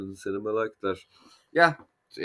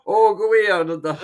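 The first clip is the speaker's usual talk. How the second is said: high, loud, hammed-up Scottish; jokey failed Scottish accent; attempted Scottish accent; 10 semitones higher, 8 dB louder than usual. Shown as a demonstration. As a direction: have a bad go at Scottish, breaking into a laugh. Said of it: "trying do a Scottish".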